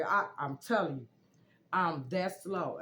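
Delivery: ordinary talk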